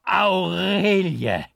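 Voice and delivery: long, high anguished shout; loud cry. A narrator's reading. Desperate prisoner crying his beloved's name, long and high. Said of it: "schrie ich laut auf".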